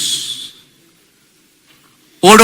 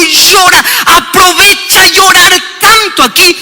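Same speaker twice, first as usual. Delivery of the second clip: urgent preaching shout; 7 semitones higher than usual. Acted. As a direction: urge him on with full force. Pastor urges man to cry.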